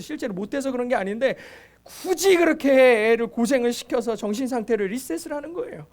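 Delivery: usual speaking voice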